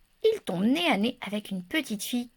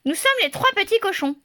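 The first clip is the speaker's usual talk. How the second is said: piglets' voice; 9 semitones higher, 4 dB louder than usual. A narrator's reading. Piglets introduce themselves.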